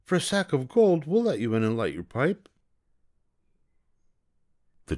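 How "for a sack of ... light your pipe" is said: put-on very high eager kids; children jumping at the gold; children agreeing for gold, high and eager; excited children. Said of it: "they cried".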